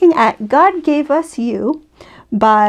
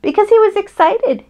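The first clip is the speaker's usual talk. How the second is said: excited; 10 semitones higher than usual. A narrator's reading. Mother recalls papa's joy.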